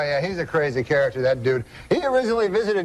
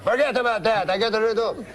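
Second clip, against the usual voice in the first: put-on higher-pitched comic French-Canadian accent; Jean-Luc's French-Canadian accent; 5 semitones higher than usual. Uncle answers the cab driver, higher French-Canadian accent. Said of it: "That's how he talks".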